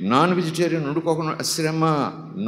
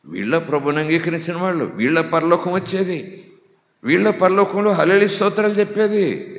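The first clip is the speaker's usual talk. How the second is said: indignant preaching, building; 5 semitones higher, 6 dB louder than usual. Real, not acted. Preacher denouncing false believers, indignant preaching rising to full force. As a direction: climb to a high, loud preacher's shout.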